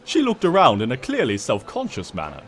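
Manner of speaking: ordinary delivery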